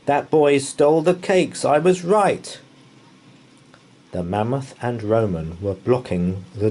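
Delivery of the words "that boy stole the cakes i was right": loud, high accusing bellow. Acted. A voice catches the boy, bellowing loud. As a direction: roar it, pointing him out. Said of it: "a voice bellowed".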